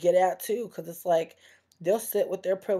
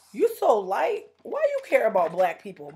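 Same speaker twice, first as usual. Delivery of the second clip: higher, louder mock challenge; mocking imitation; 4 semitones higher, 5 dB louder than usual. Mimicking people who question a light-skinned person, mocking. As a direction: imitate a doubter in a pushy, raised pitch.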